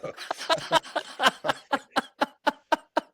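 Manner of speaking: a burst of high laughter; high rising giggle; high giggling laugh. Real, not acted. Laughs at own joke.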